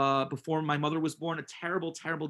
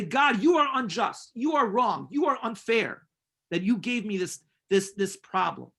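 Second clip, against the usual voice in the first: higher, emphatic mock protest; acted indignant accusation; 5 semitones higher, 4 dB louder than usual. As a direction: accuse God like an aggrieved child. Acts out people accusing God.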